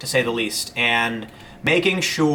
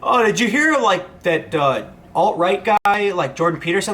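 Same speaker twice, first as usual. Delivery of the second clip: higher, breezy gossip voice, lightly mocking; mock gossiping journalist; 5 semitones higher than usual. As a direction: play a clueless journalist passing on hearsay. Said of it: "one of them will go".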